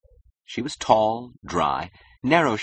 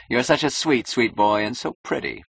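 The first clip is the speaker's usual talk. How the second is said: high, cooing mock sweetness; teasing, sugary condescension; mocking gush; 5 semitones higher, 3 dB louder than usual. Client mockingly gushes at him.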